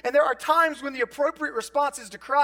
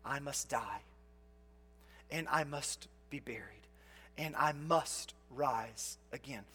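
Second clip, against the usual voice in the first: solemn quiet quote; 8 semitones lower, 12 dB quieter, slower than usual. Retold or imitated. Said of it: "he said this explicitly".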